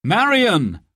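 high-pitched call to someone. A narrator's reading. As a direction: call her name across the house. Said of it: "called Robin".

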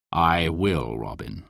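low, quiet knightly reply; low-voiced mysterious knight. A narrator's reading. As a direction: answer deep and measured.

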